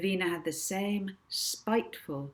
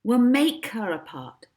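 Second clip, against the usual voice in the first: confident Molly; 5 semitones higher, 10 dB louder than usual. Acted. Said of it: "said Molly".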